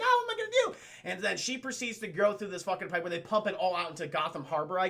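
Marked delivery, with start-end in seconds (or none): 0.0-0.8 mock helpless whine. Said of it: "She's like".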